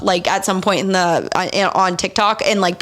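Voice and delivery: ordinary delivery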